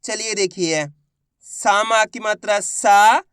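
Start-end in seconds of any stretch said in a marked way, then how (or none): 1.5-3.2 slow spelling, final सा stretched long and rising; syllable demo. Shown as a demonstration. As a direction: hold the last सा. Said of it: "किस तरीके से एक एक अक्षर का उच्चारण".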